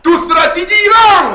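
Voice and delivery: high loud declaration; loud preaching jab; triumphant shout. Real, not acted. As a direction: hit it like a punchline. Punchline to audience.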